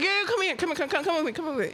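retold urgent call for help; urgent beckoning. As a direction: hurry him along, softening. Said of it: "I was like".

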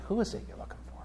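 whispered, breathy repeat of the question; hushed echo. Acted. Pastor repeating Jesus' question to the congregation, whispered. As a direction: let the line hang in a near whisper.

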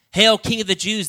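sneering high mock hail. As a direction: jeer it like a soldier. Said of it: "they mocked as they slapped him".

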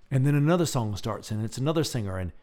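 normal talking voice

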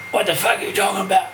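quick, raspy imitation. Musician's line offered for sampling, imitated rasp. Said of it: "here's [name] saying".

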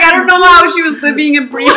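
can barely get the quote out for laughing. Real, not acted. Quoting doctor while laughing hard.

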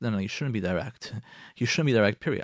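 usual speaking voice

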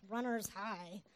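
deadpan brush-off of a friend's bragging, played for laughs; mock sarcasm. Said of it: "I'm like".

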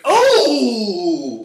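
loud, high crowd gasp of shock. Retold or imitated. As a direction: blast out a stunned oh as the crowd. Crowd reaction to burn.

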